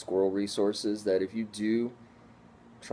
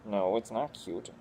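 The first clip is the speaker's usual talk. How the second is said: a hint of a grumpy squirrel; voicing the squirrel. Voicing her baby squirrel objecting, mildly grumpy. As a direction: lightly voice the pet's protest. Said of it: "I'm sure that he's like".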